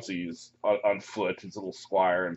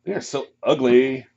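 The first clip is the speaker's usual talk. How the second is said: snooty elf; 5 semitones higher, 6 dB louder than usual. Acted. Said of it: "they say".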